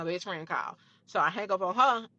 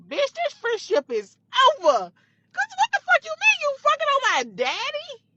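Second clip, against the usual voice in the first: screaming at the best friend through the car window; furious scream; 7 dB louder than usual. Retold or imitated. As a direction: explode at the friend who slept with your dad. Confronting the friend at the pump.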